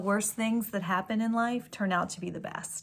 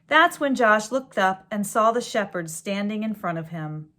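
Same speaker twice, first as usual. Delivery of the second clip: gentle storybook read-aloud; story reading; 3 semitones higher, 9 dB louder than usual. A narrator's reading. Reading a picture book to children, storybook voice. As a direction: read it like a bedtime story to kids.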